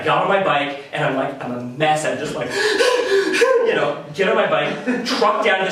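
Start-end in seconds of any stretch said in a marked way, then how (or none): 2.6-3.6 high, gasping mock sob; dramatic gasp. Recalling his breakup meltdown, a high gasping sound. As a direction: throw in a big hammed-up gasp. Falling apart after finding the note.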